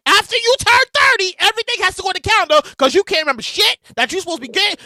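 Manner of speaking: ordinary talk, nothing marked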